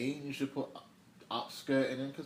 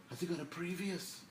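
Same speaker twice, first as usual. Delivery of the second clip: high-pitched mock Cockney question; terrible Cockney accent; 7 semitones higher, 3 dB quieter than usual. Quoting The Bill to the viewers, terrible Cockney accent. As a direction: ask it in a badly faked Cockney accent. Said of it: "that's just my terrible accent".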